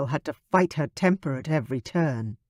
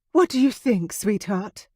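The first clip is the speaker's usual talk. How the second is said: excited mom; 6 semitones higher than usual. Acted. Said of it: "Her voice held excitement".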